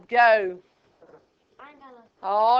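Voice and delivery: normal talking voice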